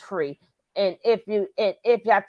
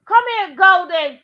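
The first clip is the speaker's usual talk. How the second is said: loud, high call across the room; calling the cat; 9 semitones higher, 8 dB louder than usual. Real, not acted.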